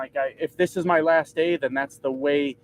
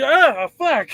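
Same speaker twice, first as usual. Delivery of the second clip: high, loud startled curse from the driver; 10 semitones higher, 6 dB louder than usual. Retold or imitated. Driver jolted by the horn, startled curse. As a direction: jump out of your skin mid-curse.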